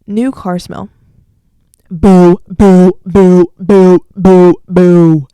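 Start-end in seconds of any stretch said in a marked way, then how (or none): 1.9-5.3 loud, repeated heckling; forceful rhythmic booing; jeering boos. Real, not acted. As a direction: boo it off the stage. Heckles new car smell.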